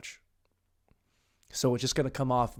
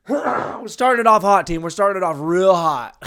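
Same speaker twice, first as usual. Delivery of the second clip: half-laughing loud call to the team; louder hype, grinning; loud excited; 5 semitones higher, 9 dB louder than usual. Real, not acted. Exclaims after laughing.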